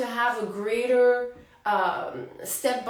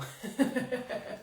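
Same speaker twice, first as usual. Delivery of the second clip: breathy, quiet laugh; quiet voiceless giggle; soft chuckle; 7 dB quieter than usual. Real, not acted. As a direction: laugh softly at your own confusion. Amused by her own confusion.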